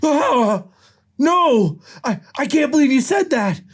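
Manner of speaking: high, hammed-up heartbreak; distraught disbelief. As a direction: recoil in high-pitched shock. Nick distraught at Judy's refusal.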